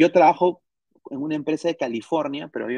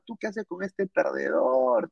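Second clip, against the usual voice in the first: a mildly slowed, raised mock voice of the disapproving mother; mother scolding daughter; 5 semitones higher than usual. Korean mother scolding her daughter about the boyfriend, mild mock scorn. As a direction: sneer at the loser boyfriend, lightly. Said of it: "agarró a su hija".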